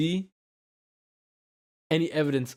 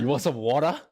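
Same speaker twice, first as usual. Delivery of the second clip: lightly voiced Boston mom, "water" with dropped r; mild Boston accent impression. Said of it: "a little bit of a Boston accent".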